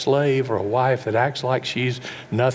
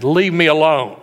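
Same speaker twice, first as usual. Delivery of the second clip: louder curt brush-off; snapping refusal; 3 semitones higher, 7 dB louder than usual. Retold or imitated. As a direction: push God away sharply. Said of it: "how many times you said".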